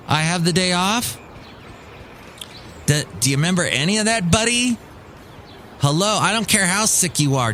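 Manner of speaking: mock exasperation. Boss answering a sick text on his day off, exasperated. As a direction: talk down to a clueless employee.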